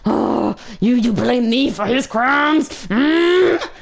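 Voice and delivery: slow, offended disbelief ending in a growly hum; annoyed. Retold or imitated. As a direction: splutter in mock outrage. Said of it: "I was so annoyed".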